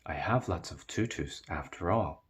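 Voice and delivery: ordinary delivery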